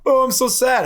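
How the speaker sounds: exaggerated whiny sad voice, pitched high and loud; mock sadness. Sailors mocking a soppy reaction, hammed-up high whiny sorrow. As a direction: overplay the sad lament to mock it. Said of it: "you're not like".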